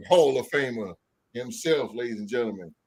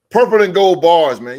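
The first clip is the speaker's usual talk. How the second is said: loud announcer call of the show name; hype shout; 3 semitones higher, 11 dB louder than usual. Real, not acted. Host announcing the guest's show, loud hype call. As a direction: sell the name like a ring announcer.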